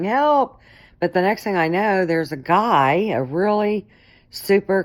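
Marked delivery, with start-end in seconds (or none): 0.0-0.6 high, louder cry for help; imitated scream for help. Woman calling to passing drivers for help, screaming. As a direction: call out sharply higher, as if flagging down drivers.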